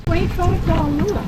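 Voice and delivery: loud, high disbelief; shocked at the price; surprised. Real, not acted. Hearing the lure's price.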